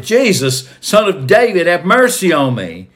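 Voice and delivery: shouted plea. A narrator's reading. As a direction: call out loudly to someone passing by. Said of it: "And so he shouted".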